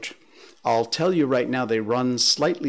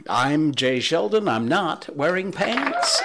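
announcer intro; 6 semitones higher, 4 dB louder than usual. Acted. Show catchphrase intro, announced.

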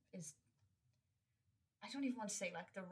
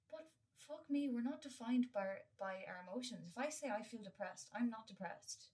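normal talking voice

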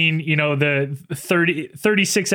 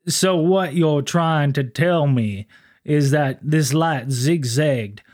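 slow, smooth, polite officer; much slower than usual. Retold or imitated. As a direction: ease the words out like a courtly lawman. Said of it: "that smooth voice here".

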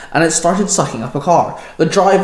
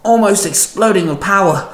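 wincing; 4 semitones higher than usual. A narrator's reading. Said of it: "Penny winced".

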